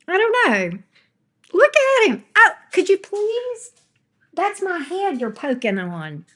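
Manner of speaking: squeaky pet talk. Real, not acted. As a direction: squeal at the pup poking her head. Squeaky talk to dog Baxter.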